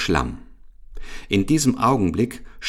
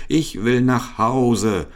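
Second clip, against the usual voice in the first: high, slow wail of protest; drawn-out cry; 6 semitones higher, 4 dB louder, slower than usual. A narrator's reading. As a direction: stretch every word into a desperate cry. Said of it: "schrie der alte Affe".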